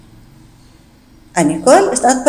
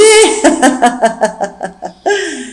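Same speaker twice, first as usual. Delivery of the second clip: a long helpless giggle; maid's giggle; 4 semitones higher than usual. Acted. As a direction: laugh in his face. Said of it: "Nicole n'arrête pas de rire".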